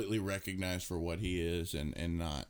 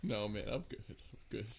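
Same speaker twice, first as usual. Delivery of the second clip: choked up; 6 dB quieter than usual. Real, not acted. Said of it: "[name] is crying his eyes out right now".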